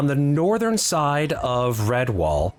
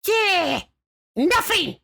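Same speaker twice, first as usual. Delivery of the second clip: squeaky goblin sidekick; slower than usual. Acted. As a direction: pipe up high like a wiry little goblin.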